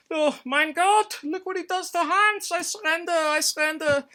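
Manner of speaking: put-on German soldier begging to surrender in a shrill German accent; panicked German accent. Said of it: "And the Germans are like".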